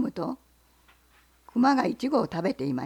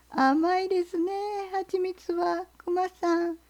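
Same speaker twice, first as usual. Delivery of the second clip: fox; 7 semitones higher, slower than usual. Acted. Fox voiced.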